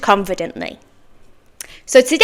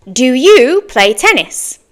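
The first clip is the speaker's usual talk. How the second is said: slow model question. Shown as a demonstration. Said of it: "Here are some example questions".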